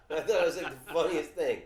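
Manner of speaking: laughing through the words; real giggle while telling; amused, laughing out the words. Real, not acted.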